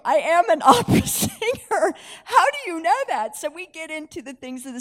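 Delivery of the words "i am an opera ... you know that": laughing disbelief. Retold or imitated. Opera singer amazed, retold.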